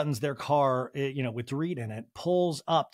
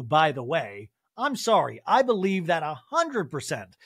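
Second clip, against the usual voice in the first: normal talking voice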